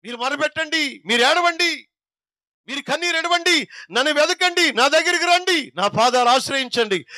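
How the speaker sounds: high, fervent pleading call as God; voicing God. Preacher voicing God inviting his people to weep. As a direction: cry out God's invitation, slowly and high. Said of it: "దేవుడు సెలవిస్తున్నాడు".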